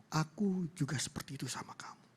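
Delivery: hushed, half-whispered reassurance; tender confession. Retold or imitated. Father answering his daughter's love, tenderly hushed. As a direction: let the voice sink into a soft whisper.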